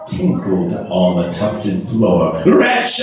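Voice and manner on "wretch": high anguished cry; sudden high cry of despair; cried out. Acted. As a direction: fling the word at the bird. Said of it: "I cried".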